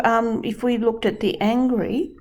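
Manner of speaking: ordinary talk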